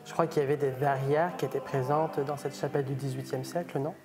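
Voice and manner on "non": ordinary delivery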